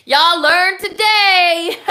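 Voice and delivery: Y'all learned today drawn out high and loud; singsong gloating. Shown as a demonstration. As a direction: sing it out slowly to rub it in. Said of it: "Well, y'all learned today".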